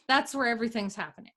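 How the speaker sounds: ordinary talk, nothing marked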